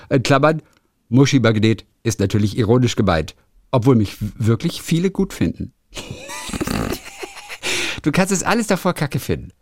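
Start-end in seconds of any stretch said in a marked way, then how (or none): 6.0-8.0 breathless high laugh; high squealing laugh. Real, not acted. Laughing at the book's bracket note, squealing.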